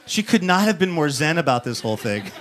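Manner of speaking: ordinary talk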